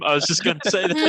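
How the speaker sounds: high, laughing agreement. Real, not acted. Player agrees the drow sounds like Tommy Wiseau, laughing. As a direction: say it through giggles.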